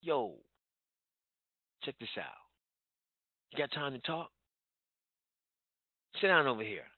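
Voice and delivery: low, soft and lazy, no urgency; slow laid-back pitch. Shown as a demonstration. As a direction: drag it out like you've got all day. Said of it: "do you sound like this?".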